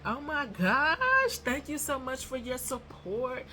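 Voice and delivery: high, slow, sugary gratitude; gushing thanks. Retold or imitated. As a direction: lay on the sweet fan thanks. Thanking a fan.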